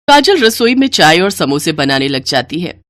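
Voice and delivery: ordinary delivery, nothing marked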